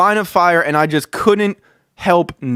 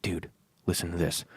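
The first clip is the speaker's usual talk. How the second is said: hushed, much softer setup line; conspiratorial whisper; 10 semitones lower, 16 dB quieter, faster than usual. Retold or imitated. Larry whispering before farting.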